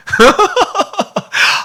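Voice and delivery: high breathy laugh of delight; a high, moved laugh before tears; emotional laugh. Real, not acted. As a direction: laugh out in overwhelmed joy. Preacher overcome by the meaning.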